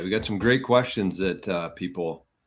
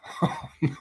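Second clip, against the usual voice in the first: quick airless chuckle at the mishap; short rueful laugh; 7 semitones higher than usual. Real, not acted. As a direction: laugh it off in disbelief. Laughs at being logged out.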